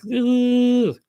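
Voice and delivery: high, drawn-out little squeal; imitated uneasy squeal. Us kids facing the bullies, imitated uneasy squeal.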